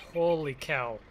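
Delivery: normal talking voice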